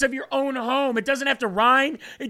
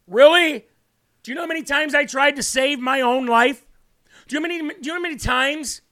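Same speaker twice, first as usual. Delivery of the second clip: louder, higher, pressing questions at a doubter; raised voice; 4 semitones higher, 3 dB louder, faster than usual. Real, not acted. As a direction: push back hard, voice climbing. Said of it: "Why is he screaming?".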